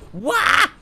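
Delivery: sudden high, loud cry of fright; startled yelp. Real, not acted. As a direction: jump out of your skin.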